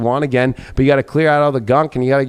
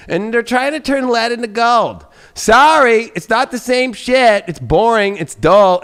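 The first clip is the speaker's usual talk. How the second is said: louder, higher, scornful burst; loud scornful complaint about lead; loud rant; 7 semitones higher, 5 dB louder, slower than usual. Real, not acted. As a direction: sound fed up with lead. Ranting that lead is not gold.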